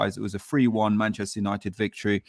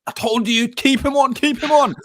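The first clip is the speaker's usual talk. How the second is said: higher, louder gleeful gloat; gloating shout; 7 dB louder than usual. Retold or imitated. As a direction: crow it like a fan proven right. Retelling friend's gloating in stadium.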